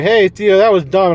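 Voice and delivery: high, loud teasing call; mock scolding friend. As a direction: call him out like a smug buddy. Imagined people mocking him.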